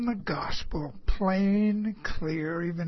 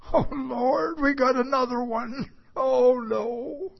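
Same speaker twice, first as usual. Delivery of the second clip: a mock groan of despair, pitched up, with a cry in it; dismayed; 6 semitones higher than usual. A preacher's dismayed inner groan at another unbeliever. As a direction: play it as a weary inward lament.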